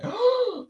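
high, loud mock cry; acted yelp; imitated child's cry. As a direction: imitate a kid who just got hit.

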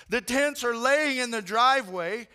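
ordinary delivery

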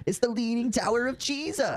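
high, slow goofy Pauly Shore voice; Pauly Shore impression. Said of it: "what does Pauly Shore sound like".

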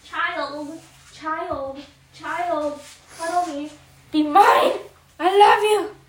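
smother the dog with love; doting pet talk. Real, not acted. Cuddling her dog.

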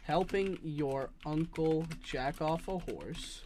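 slow, careful dictation; dictating slowly. Shown as a demonstration. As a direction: spell it out slowly. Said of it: "I'll type it out for you".

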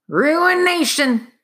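high, slow, drawn-out mutter; muttering miner. Acted. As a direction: brood over the word like a ruined man. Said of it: "the miner began to mutter".

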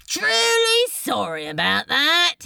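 high, loud, breezy apology; mock-sorry shout; insincere called-out apology. A narrator's reading. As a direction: call it across a panicking room. Calling apology over panicking crowd.

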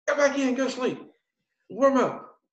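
high-pitched mock sergeant commands; sergeant giving orders. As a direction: order the recruit back inside as the sergeant. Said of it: "He's like".

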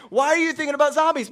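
fast, loud, high question; exasperated dad. Retold or imitated. Dad to his son about his zombie worries, fast and high. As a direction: ask in amused exasperation.

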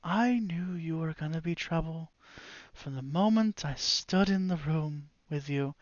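quiet wizard seething at the party; quiet menacing wizard. Acted. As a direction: hold the anger down to a quiet warning. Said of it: "He goes".

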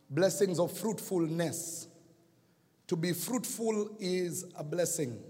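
usual speaking voice

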